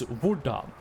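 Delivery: hvordan said the Norwegian way, roughly vor-dan. Shown as a demonstration. Teaching chat the Norwegian word for how. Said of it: "and how is".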